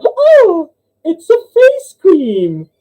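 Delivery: long, high squeal of discovery; amazed joke nun. Acted. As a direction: draw out the punchline in falsetto. Acting nun at the punchline.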